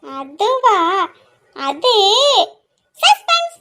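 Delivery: milk the mystery; girl teases her cousin, won't tell where they go; teasing suspense. Acted. Teasing refusal to reveal plan.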